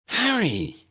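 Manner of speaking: high sharp indignant cry of his name; protesting. A narrator's reading. Ginny objecting to Harry shutting Dennis out, indignant. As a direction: object to him at once. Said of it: "Ginny protested".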